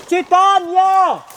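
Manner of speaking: calling out. Acted. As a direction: call out to someone far away. Calling for a distant friend. (laut und gedehnt in die Ferne rufen)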